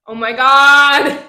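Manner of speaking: loud, drawn-out groan of frustration; slow, loud cry of frustration; exasperated outburst. Real, not acted. Exasperated at forgetting the word.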